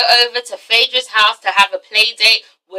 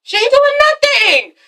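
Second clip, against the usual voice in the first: much higher, slower, stretched out; high exasperated cry; 13 semitones higher, slower than usual. Real, not acted. Vlogger exclaiming to viewers, pitched way up. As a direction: wail it in disbelief. Outraged rant about Kim.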